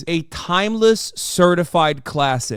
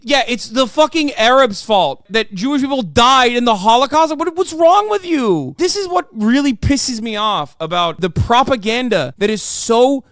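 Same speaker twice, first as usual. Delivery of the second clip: ordinary talk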